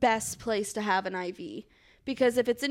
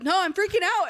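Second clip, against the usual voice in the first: high, panicked protest; higher, frantic retort; mock screaming; 7 semitones higher, faster than usual. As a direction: cry it out in a retold panic. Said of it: "I just start screaming".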